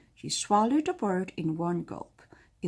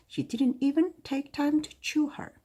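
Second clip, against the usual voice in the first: plain reading voice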